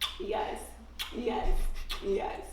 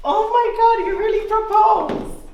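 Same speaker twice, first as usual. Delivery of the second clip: loud, higher-pitched amazement; real astonished outburst at a proposal; thrilled disbelief; 4 semitones higher, 5 dB louder than usual. Real, not acted. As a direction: exclaim it high and loud. Reacting to a marriage proposal.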